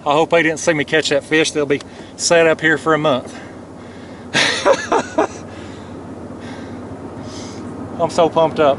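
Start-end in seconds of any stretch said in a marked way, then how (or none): 4.1-7.9 long, high, wheezy laugh trailing off; soft high-pitched giggling fit; giddy laugh. Real, not acted. Angler pumped up after the catch, giddy laugh.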